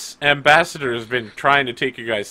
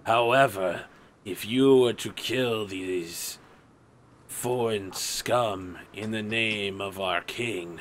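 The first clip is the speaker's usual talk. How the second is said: sneering gloating dark voice; 4 semitones lower, 7 dB quieter than usual. Acted. GM voices dead guard answering.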